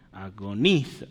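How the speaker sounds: "Agoniza" with the NI stretched, "agoNIza"; accent on the I. Shown as a demonstration. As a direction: stress the I in "Agoniza" as a partial attempt. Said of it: "el acento está en la I".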